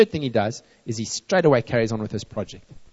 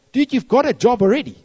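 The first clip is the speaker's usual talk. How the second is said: much higher, louder scolding; exasperated retort; 11 semitones higher, 7 dB louder than usual. Retold or imitated. Preacher to a friend moaning about work, exasperated. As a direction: brush the complaint off, loud and high.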